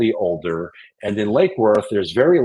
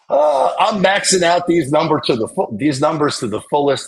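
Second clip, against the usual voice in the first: excited; 7 semitones higher than usual. Real, not acted. Excited bragging.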